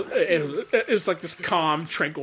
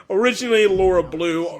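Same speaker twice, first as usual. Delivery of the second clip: normal talking voice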